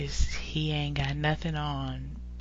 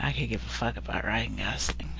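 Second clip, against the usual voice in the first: whispered aside; 6 semitones lower, 5 dB quieter than usual. Real, not acted. Dismissive aside about Gosling.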